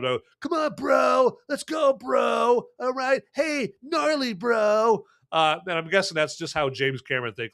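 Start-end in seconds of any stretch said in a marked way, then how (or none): 0.4-5.0 put-on sling it like a laid-back surfer kid; surfer-dude teen. Mocking how Cameron's teen characters talk, high bro voice. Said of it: "that's how they talk all the time".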